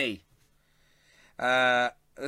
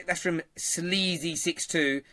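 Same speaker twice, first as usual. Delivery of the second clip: ordinary talk, nothing marked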